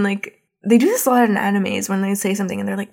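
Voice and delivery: ordinary talk, nothing marked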